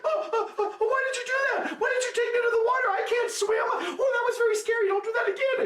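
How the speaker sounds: very high, panicky whine; frightened monkey. Acted. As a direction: sputter it out, shaken and soaked. Scared wet monkey complains.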